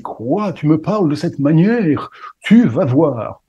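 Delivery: hammed-up menace, building to a slow threat; mock fury. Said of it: "ça l'énerve encore plus".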